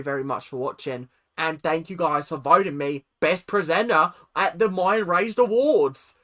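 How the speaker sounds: presenter [name] in an Australian accent, mock-earnest; a cheerful Aussie presenter thanking his voters. [name] thanks viewers for his award in a hammed-up Australian accent. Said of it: "here is [name]'s thank you".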